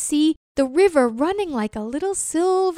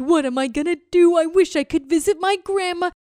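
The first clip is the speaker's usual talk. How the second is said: sighing turtle; 4 semitones higher, 4 dB louder than usual. Acted. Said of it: "And so he sighed".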